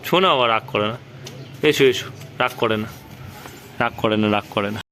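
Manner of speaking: gentle, soft, hushed coaxing. Real, not acted. (শিশুকে নরম গলায় আদর করে ভোলানো)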